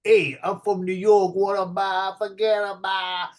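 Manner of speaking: full New York accent, broad and pushy; heavy New York accent. Shown as a demonstration. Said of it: "So it's not so New York".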